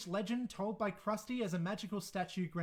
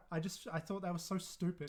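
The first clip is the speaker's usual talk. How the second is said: ordinary talk, nothing marked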